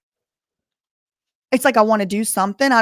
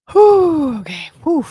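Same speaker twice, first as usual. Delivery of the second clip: relieved whew; 7 semitones higher, 9 dB louder, slower than usual. Real, not acted. Relieved exhale before moving on.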